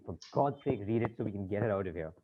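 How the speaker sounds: hushed, weary exasperation; exhausted pleading. Acted. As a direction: plead quietly, worn right down. Worn-out banker begs assistant to comply.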